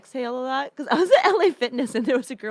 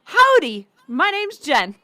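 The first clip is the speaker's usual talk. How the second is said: loud, higher mock-friendly introduction; hearty greeting; 4 semitones higher, 10 dB louder, much slower than usual. Quoting therapist's advice on making friends, hammed-up greeting. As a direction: belt out an over-eager hello.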